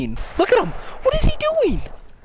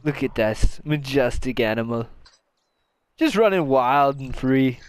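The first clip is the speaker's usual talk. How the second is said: nature documentary; 8 semitones lower than usual. Pretend. Narrates friend as wild animal.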